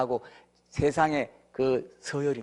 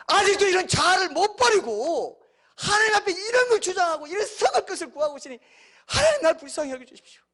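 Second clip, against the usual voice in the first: high, pleading cry of repentance; repentant prayer; 13 semitones higher, 3 dB louder than usual. Acted. Repenting believer pleads with God for mercy, high and earnest. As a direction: beg for mercy from the heart.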